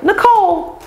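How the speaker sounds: high-pitched mock exclamation; imitated exclamation. Stylist answering the rep's call, mock exclaiming. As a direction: call the name out high, acting it.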